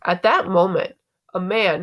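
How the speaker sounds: ordinary delivery, nothing marked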